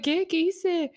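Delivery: put-on high, puzzled little-kid voice; confused kid. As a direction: play the innocent child who doesn't get it. Kid confused at dad's anger.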